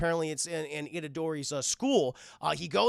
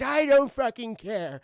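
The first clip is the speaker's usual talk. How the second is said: put-on loud, slow, high old-man dismissal; hammed-up cantankerous elder; grumpy grandpa; 5 semitones higher, 6 dB louder, slower than usual. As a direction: drag it out in stubborn annoyance. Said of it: "Oh, Grandpa".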